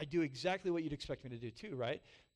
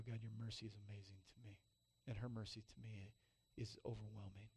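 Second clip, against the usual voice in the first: softer, hushed, quicker thanks to God; quiet grateful prayer; 3 semitones lower, 8 dB quieter than usual. Retold or imitated. A pastor thanking God in awe, softly hushed. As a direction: breathe it out as a humbled prayer.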